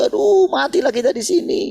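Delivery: high, loud panicked wail; cursing in despair. Retold or imitated. Frightened people cursing at the border, shrill loud panicked wail. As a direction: wail that all is lost. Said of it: "sudah mengutuki".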